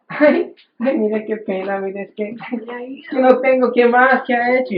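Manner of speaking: quejido agudo y suplicante; pariente pedigüeño. Retold or imitated. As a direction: imita al pariente que llama solo para pedir. Pariente interesado pide favores. (la voz aguda y lastimera del familiar interesado)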